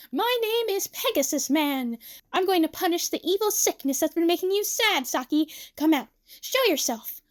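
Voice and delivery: put-on high boyish superhero bravado; hammy, grand make-believe challenge; little Tsukasa, heroic. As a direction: declaim like a child playing a caped hero. Voices little Tsukasa playing superhero.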